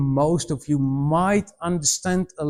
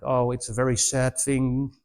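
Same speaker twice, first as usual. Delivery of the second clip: mock solemn; 5 semitones lower, 4 dB quieter than usual. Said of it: "you need to sit there like".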